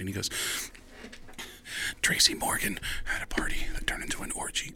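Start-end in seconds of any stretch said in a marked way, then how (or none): 2.0-4.7 hushed, scandalized whisper; super nervous. Retold or imitated. Agent confiding party gossip to comic, nervous whisper. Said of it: "super nervous".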